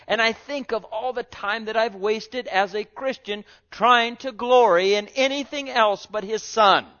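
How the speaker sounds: loud, high-pitched preaching with held emphasis; full-force preaching. Acted. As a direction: lift the whole church, preach it out.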